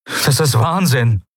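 high, slow cry of disbelief; exclaiming. A narrator's reading. Kai rejects his fixed idea, exclaiming. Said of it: "rief er".

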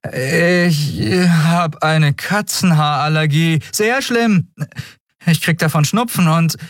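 put-on a scared kid hamming up an allergy; nervous boy faking. A narrator's reading. Miko fakes a cat allergy to the Skria, high and hammed-up.

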